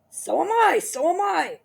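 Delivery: high, loud whining cry; frightened cry. Acted. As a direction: whimper it back in fright. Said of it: "cried Mister Twit".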